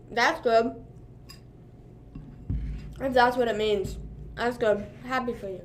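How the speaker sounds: chewing while talking, mouth barely open; mouth full. Real, not acted. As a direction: talk through a mouthful of food. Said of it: "It's 'cause I'm chewing".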